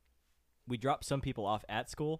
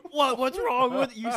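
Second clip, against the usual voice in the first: high, loud, startled what; alarmed question; 14 semitones higher, 12 dB louder than usual. Retold or imitated. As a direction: shout it in alarm. Friends react to the outburst.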